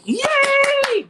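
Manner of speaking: high squeal of delight; mock cheer. Telling the man she's thrilled, high happy squeal. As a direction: squeal it like you won.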